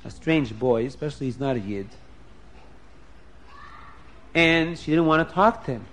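usual speaking voice

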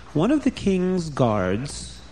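usual speaking voice

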